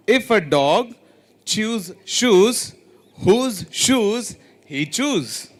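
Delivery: slowed right down, word by word; careful teacher pace; slow demo. Shown as a demonstration. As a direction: spell it out slowly for the class. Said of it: "So I'll repeat it again".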